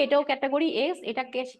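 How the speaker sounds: usual speaking voice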